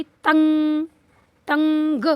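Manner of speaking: तंग loud, with a long hummed nasal; drawn-out anusvara. Shown as a demonstration. Teacher showing the dotted syllable, held nasal. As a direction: hold the nasal in तंग slowly. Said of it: "इसको कैसे पढ़ेंगे".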